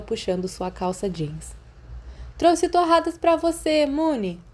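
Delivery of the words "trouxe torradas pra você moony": higher, friendly call through a closed door; lightly voiced Sirius, offhand and kind. Sirius calling to Remus through the bathroom door, friendly. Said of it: "a voz de Sirius continuou".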